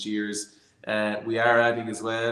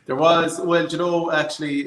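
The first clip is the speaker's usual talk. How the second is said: amused burst; 7 semitones higher, 9 dB louder than usual. Real, not acted. Laughing reply to joke.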